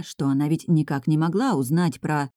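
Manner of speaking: plain reading voice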